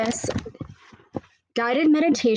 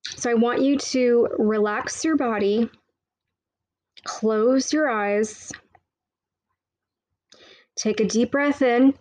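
right in your ear. Acted. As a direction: guide the listener into calm. Meditation guide begins.

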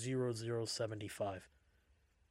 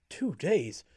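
startled, disbelieving blurt; blurted disbelief; 5 semitones higher, 7 dB louder than usual. A narrator's reading. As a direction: blurt it out in shock. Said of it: "couldn't help but blurt out".

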